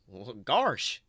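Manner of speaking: a light hint of a Goofy drawl. Goofy musing to himself, a lightly goofy voice. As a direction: play it as a dopey Goofy. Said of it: "he said to himself".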